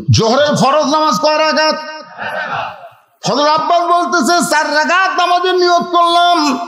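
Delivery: high, chanted sermon delivery; full-force preaching. Real, not acted. Preacher urging the congregation to pay attention, full-force preaching.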